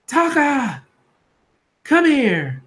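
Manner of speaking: high, sharp acted call; stern summons. Mother calling Taka over after he hit Alba, high stern summons.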